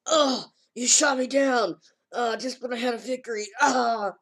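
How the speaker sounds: high, loud, whiny protest; squealing mock despair; whiny outcry. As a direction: wail it like a wounded anime boy. Boy whines after being shot down.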